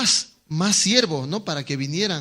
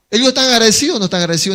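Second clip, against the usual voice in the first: rhetorical challenge; 5 semitones higher, 6 dB louder, much faster than usual. Real, not acted. Preacher challenges congregation.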